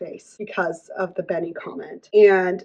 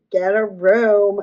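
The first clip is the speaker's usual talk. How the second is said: slow, louder teasing jab; teasing Veronica; 6 dB louder, much slower than usual. Retold or imitated. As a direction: draw it out loud, mocking the couple. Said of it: "Veronica comes out and is like".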